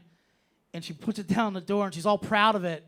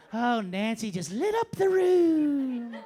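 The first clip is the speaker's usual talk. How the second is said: higher, drawn-out, over-sweet eulogy; hammed-up adoring mourner; mock gushing praise; 6 semitones higher, 5 dB louder, much slower than usual. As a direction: gush slowly, stretching the last word out. Mocks victim's gushing mourners.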